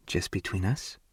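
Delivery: barely there, asking with an edge; excited whisper. A narrator's reading. She whispers back to him on the phone, hushed and tempted. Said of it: "it's in a whisper that has an edge to it".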